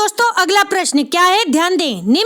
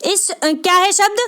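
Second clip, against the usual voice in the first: normal talking voice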